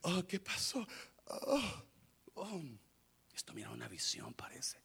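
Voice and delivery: soft, sleepy mumbling, falling to a whisper; drowsy, bewildered murmur; groggy, just woken. Acted. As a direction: play a dazed man waking up, with little voice. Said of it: "Se levanta Pedro todo modorro y dice".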